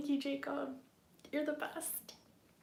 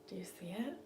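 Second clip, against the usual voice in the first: whisper; 3 semitones lower, 8 dB quieter than usual. Real, not acted. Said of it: "I'm trying to be really quiet".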